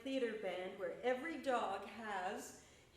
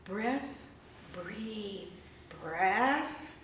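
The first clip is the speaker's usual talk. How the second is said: slow, louder rhythmic recitation; poem performance; 3 semitones higher, 11 dB louder, slower than usual. Acted. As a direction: chant it like spoken word. Performs his breath sound poem.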